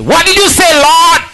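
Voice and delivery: high, loud eager question; eager believer. Retold or imitated. The believer answers the whispering voice, high and eager. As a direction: ask the Lord brightly.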